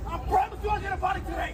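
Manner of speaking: fast high shouted threat. Retold or imitated. Attacker yelling a threat at the woman, fast and high.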